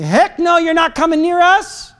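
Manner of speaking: high-pitched defiant refusal. Acted. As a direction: raise the pitch and refuse the enemy. Defiant words to the enemy.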